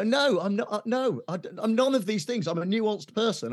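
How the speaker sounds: defensive protest. Retold or imitated. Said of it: "and you go".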